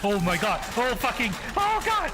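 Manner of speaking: real startled shout, rising; startled yell. Real, not acted. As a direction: yelp from a jump scare. Jump scare in game.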